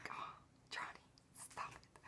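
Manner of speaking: tiny, barely voiced whisper; bashful whisper. Fan swooning over Johnny's go-ahead, bashful whisper.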